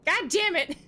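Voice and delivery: high, loud curse; exasperated outburst at the game; frustrated. Real, not acted. Losing again in the game.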